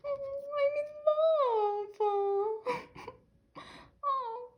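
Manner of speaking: high, slow, crying squeal; tearful swoon. Real, not acted. Fan gazing at her card, crying with love. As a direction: melt into a weepy squeal.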